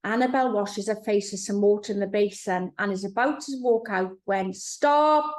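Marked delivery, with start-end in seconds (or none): 4.5-5.2 higher, louder sudden command; a loud inner voice halting her; booming stop. A narrator's reading. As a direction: bark it out like a voice in her head. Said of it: "a voice booms in her head".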